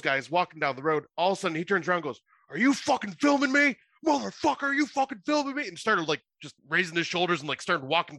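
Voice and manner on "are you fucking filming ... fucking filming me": high, agitated imitation of an irate man; mock-furious accusation; acted angry outburst. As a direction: confront someone, voice pitched way up. Acts homeless man confronting a cop.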